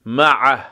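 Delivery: مع ending in a heavy ʕ squeezed from the mid throat; مع with a thick throaty ʕ; thick ain. Shown as a demonstration. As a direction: press the ع of مع hard in the throat. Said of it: "عین غلیظ".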